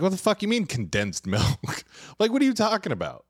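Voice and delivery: can't believe what he's hearing; high-pitched shocked disbelief; retold disbelief. Learns ramen has condensed milk.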